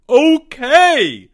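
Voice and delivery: high, loud outburst; crying out. A narrator's reading. Albert gives in to the crowd, crying out. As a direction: cry it out desperately. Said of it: "he cried out".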